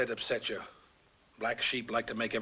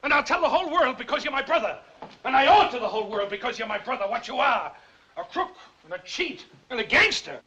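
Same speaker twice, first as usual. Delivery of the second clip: shouting accusations, much higher and louder; furious yelling; 10 semitones higher, 5 dB louder than usual. Acted. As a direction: hurl every word at your brother in rage. Brother accuses brother before strangers.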